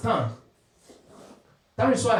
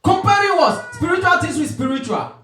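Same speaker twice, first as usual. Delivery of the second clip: loud, high rhetorical question and answer; emphatic preaching; 8 semitones higher, 6 dB louder than usual. Real, not acted. Preacher quizzing the congregation on the verse, emphatic preaching. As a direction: throw the question out hard, then answer it.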